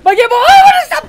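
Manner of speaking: very high, loud squealing yell; alarmed shriek. Real, not acted. Player yelling about the game, alarmed shriek. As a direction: shriek it out at the top of the voice.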